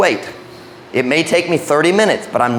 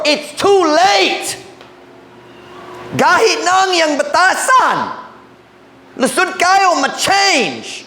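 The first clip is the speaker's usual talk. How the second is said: high, slow, emphatic preacher's cry; preaching; 13 semitones higher, slower than usual. Acted. Pastor warning parents, in rising preaching voice. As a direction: build the warning to a peak.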